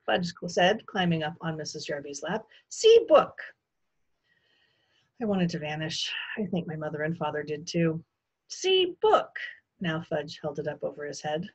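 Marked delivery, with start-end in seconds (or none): 2.7-3.4 put-on very high, loud little-kid squeal; excited toddler. A narrator's reading. Toddler shows the guest a book, squealing proudly. 8.5-9.4 put-on very high, loud little-kid squeal; excited toddler. A narrator's reading. Toddler shows the guest a book, squealing proudly.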